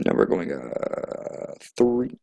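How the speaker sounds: breathy radio voice. Pretend. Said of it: "like a radio host".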